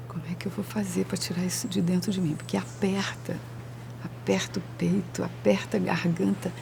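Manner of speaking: soft, anguished thinking aloud; quiet voice of someone privately struggling; hushed inner plea. Acted. Voices panicked person's inner thought.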